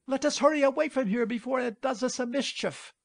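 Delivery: high, rushed panic; frightened. A narrator's reading. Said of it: "thoroughly frightened".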